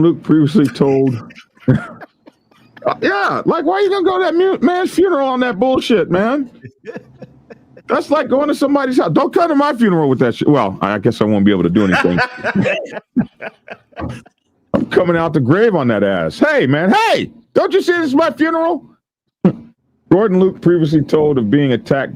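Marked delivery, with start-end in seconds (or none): 3.0-10.1 high, put-on outraged voice; mock indignant mourner. The dead man scolding the funeral crasher, high and outraged. 16.4-18.8 high, fast, put-on indignant voice; outraged ghost. The dead man yelling at his funeral crasher, high and fast.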